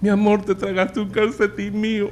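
high, soft comic whine; pinched-up, pitched-high husband voice; appalled husband. Acted. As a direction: squeak it at your wife through the stench. Husband reacts to wife's breath.

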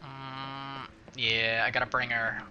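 ordinary talk

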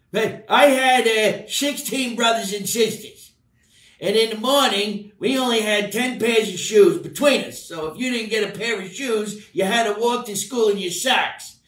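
put-on high gruff old uncle telling a hard-times tale; Uncle Tommy. Uncle boasting to kids about growing up poor, high voiced. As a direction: spin a poor-childhood yarn as the cranky uncle. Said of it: "do the pipe again".

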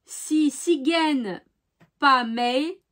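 slow, halting word-by-word reading; slow and deliberate, feeling out each syllable; careful foreign-phrase reading. Real, not acted. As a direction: sound out an unfamiliar regional phrase. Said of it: "avec mon accent, c'est, c'est pas".

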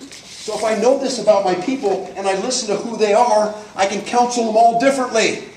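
usual speaking voice

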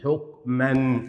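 حكما split slowly, ending in "-man"; slow حكما with a held nasal n at the end; tanween with fatha. Shown as a demonstration. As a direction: sound out "huk... man" for حكما. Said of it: "وبالفتح".

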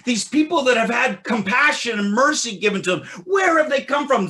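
loud, high, driving sermon voice; fiery preaching. Acted. Preacher exhorting the congregation on Zion's sons, fiery. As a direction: preach it at full force to the congregation. Preaching Isaiah forty-nine passionately.

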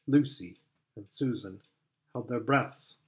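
ordinary delivery, nothing marked

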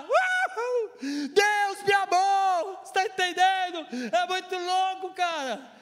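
very high, loud, stretched-out cheer; exultant shouting. Real, not acted. Preacher overjoyed that God loved him, exultant shouting. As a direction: whoop and shout it to the whole room. Exulting over God's love.

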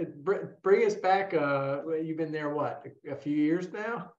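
usual speaking voice

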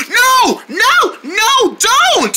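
very high, stretched cries; pretend panic. Others begging the player to stop, high panicked wail. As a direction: plead in a high cartoon wail. Pleads against loud music.

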